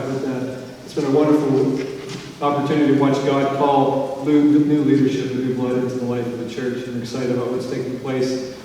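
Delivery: usual speaking voice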